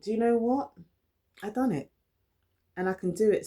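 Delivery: high, loud burst of pride; proud, self-cheering inner voice; triumphant. Retold or imitated. Triumphant inner voice after success.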